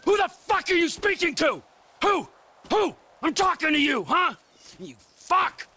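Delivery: furious yelling. Acted. Trevor screaming at the bikers after the beating, furious. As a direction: yell down at them, out of control.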